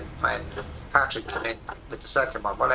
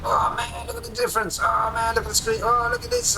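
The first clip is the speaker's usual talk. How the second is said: amazed; 11 semitones higher than usual. Retold or imitated. Showing wife the new screen, squealing in amazed delight. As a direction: squeal at the screen like a thrilled kid.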